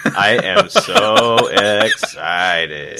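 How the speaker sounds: slow, drawn-out robotic voice; mock machine voice, laughing through it; cyborg voice. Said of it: "I was trying to sound like a cyborg".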